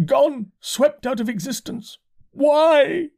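high, slow, wailing lament; lamenting curate. A narrator's reading. Curate mourning Weybridge to the narrator, high wail.

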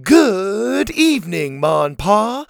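a touch of a chanting crowd; kids shouting back. A narrator's reading. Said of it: "the kids shouted back".

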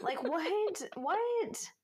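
can't believe what you heard; incredulous. Real, not acted. Disbelief at petty story.

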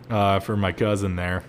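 ordinary delivery

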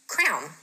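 crayon squashed into one syllable, crown; local "crayon" pronunciation. Shown as a demonstration. South Jersey speaker admitting her version, crayon as crown. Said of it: "I say this completely wrong".

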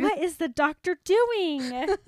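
amused outrage at the dentist; incredulous. Real, not acted. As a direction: ask it like you can't believe it. Mock outrage at teen veneers.

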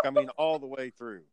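ordinary delivery

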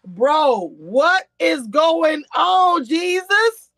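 high, slow, loud baffled cry; retold disbelief. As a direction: drag out the confusion in disbelief. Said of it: "I was like".

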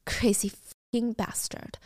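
slow, hushed whisper; whispered curse. Retold or imitated. Gia reading her boyfriend's text, whispering in disgust. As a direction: hiss it under your breath.

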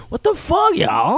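high-pitched shouted impression of an angry dad; mock mad dad. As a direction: imitate the dad getting mad. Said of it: "funny when he gets mad".

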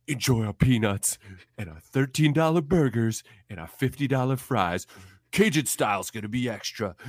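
mocking the peanut bins as a Five Guys worker; mock burger greeter. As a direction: welcome them like a cheerful but menacing employee.

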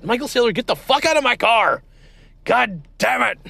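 high, loud furious yell; furious scream. Acted. Host screaming at the guest to leave his car, furious yell. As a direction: scream at him to get out.

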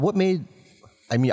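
ordinary delivery

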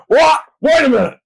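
high, loud double-take of disbelief; mock shocked crowd. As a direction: jump way up in pitch like you can't believe it. Crowd reacting to Cena's return.

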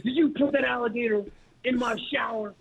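stern coach voice. Retold or imitated. Coach confronts him over prank.

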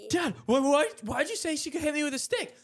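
high, indignant mock complaint; retold whining protest to a parent; mad. Boy confronts his dad, high indignant protest. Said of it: "I'm, I'm mad".

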